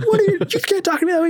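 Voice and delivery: high mock drill sergeant yell; angry drill sergeant. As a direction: imitate the sergeant losing it. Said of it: "you know, like".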